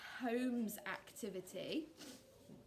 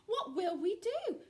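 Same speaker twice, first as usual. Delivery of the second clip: mildly distressed, higher voice; a worried duck's wail, understated; crying out; 8 semitones higher, 5 dB louder than usual. A narrator's reading. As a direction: cry it out in mild dismay. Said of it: "cried Ducky Lucky".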